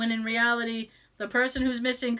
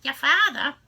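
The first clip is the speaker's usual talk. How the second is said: hammed-up Boston mother, R dropped; Boston mom accent; 3 semitones higher, 4 dB louder than usual. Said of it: "Mom, teach me the accent".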